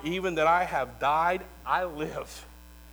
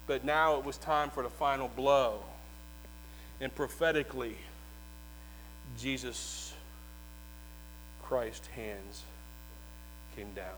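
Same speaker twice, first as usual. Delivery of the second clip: hushed sermon storytelling; 5 semitones lower, 12 dB quieter than usual. A narrator's reading. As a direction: draw the congregation in with a hush. Hushed telling of crucifixion.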